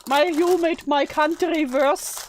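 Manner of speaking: hammed-up scolding; putting on an angry voice for show; mock angry voice. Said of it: "My angry voice sounds".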